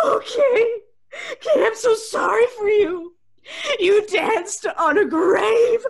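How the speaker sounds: laugh-crying, half shouting; hysterical laughter. Acted. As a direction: cackle it out through tears.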